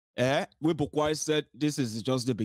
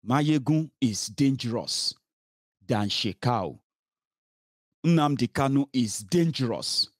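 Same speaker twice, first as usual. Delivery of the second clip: ordinary talk, nothing marked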